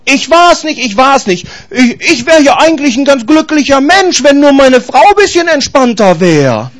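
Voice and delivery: man blaming his wife for his unhappiness, mock-whining with a fake sob; whiny excuses. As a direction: play the self-pitying victim. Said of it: "Keiner ist schuld".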